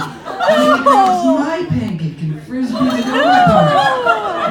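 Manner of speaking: ordinary talk, nothing marked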